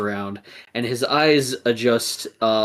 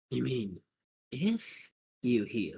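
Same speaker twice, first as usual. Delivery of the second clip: slow, soft needling; hushed villain's question; quiet taunt; 10 dB quieter, slower than usual. Acted. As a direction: taunt softly, drawing it out. Villainess taunts wounded hero.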